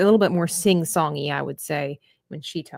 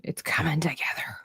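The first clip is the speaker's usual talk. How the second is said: whisper; 2 semitones lower, 7 dB quieter than usual. Retold or imitated. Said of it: "we're like".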